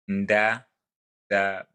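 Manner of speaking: Ndabishuriye broken slowly into separate syllables, loud and clear; slow repeat. Shown as a demonstration. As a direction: sound out Ndabishuriye syllable by syllable with pauses. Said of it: "I'll repeat that slowly".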